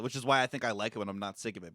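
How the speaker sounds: ordinary delivery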